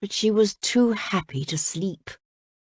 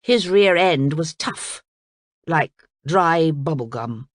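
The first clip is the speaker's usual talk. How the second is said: ordinary delivery, nothing marked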